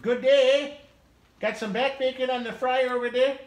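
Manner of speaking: mock Canadian. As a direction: play a cartoon Canadian. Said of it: "in Canadian?".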